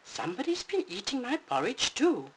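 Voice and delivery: Mama Bear finding her porridge eaten. Acted. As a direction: squeak like a fussy mother bear.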